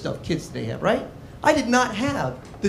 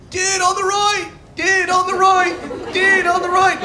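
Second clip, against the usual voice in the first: put-on skater kid voice; 11 semitones higher than usual. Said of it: "He's like".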